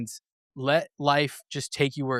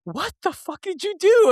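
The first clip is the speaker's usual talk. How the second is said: much higher, louder stunned disbelief; mock incredulous; 4 dB louder than usual. Girl left at the exit, hammed-up shocked disbelief. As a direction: imitate her baffled outburst.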